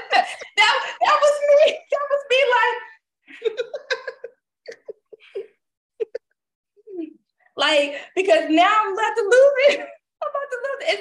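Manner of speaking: high, loud laughing yell; losing it through laughter; laughing breakdown. Acted. Mom recalling meltdown over car seatbelt, high laughing yell. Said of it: "how Regina Hall was in that video".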